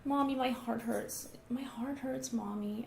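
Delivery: put-on slow, whimpering little-boy voice, the repeat nearly whispered; faking child. Son telling Mommy his heart hurts, whimpering. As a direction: play up a pitiful, drawn-out complaint. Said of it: "he was kind of faking it".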